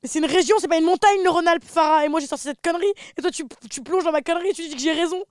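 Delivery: high, loud laughing protest; excited teasing. Real, not acted. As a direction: rib her loudly for agreeing. Teasing friend over her blunder.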